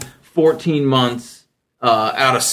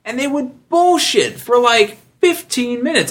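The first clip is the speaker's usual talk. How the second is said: over-friendly small talk, pitched way up; mock schmoozy coworker; 11 semitones higher than usual. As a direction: ham up a fake-friendly colleague. Coworkers chatting client up, mimicked.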